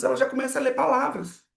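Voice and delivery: usual speaking voice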